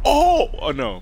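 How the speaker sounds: real loud high jump-scare yell; startled scream. Real, not acted. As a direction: shriek in sudden fright. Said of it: "I didn't mean to scream".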